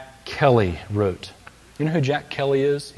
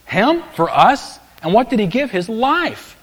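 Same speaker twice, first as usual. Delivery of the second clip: amazed disbelief; 9 semitones higher, 4 dB louder than usual. Retold or imitated. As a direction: voice John's astonishment at the sacrifice. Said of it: "It's as if John is saying".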